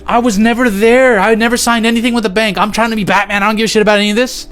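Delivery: high-pitched, insistent protest; exasperated mock denial; indignant denial. Acted protest against false accusations.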